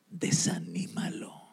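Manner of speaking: low, hushed, sinister command; soft devilish whisper; Satan's order. Acted.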